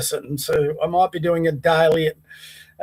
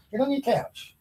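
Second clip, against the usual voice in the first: much softer, hushed order to the dog; soft firm command; 5 semitones higher, 11 dB quieter than usual. Real, not acted. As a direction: quietly insist the dog go back. Quietly ordering dog back to couch.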